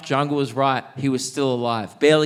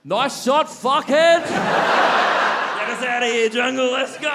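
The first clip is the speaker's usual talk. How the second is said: very high, loud, drawn-out yelling; heckling shout; 9 semitones higher, 4 dB louder, slower than usual. Acted. Wounded soldier heckles the enemy, shouting. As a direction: holler it high across a battlefield. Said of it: "he heckled the enemy".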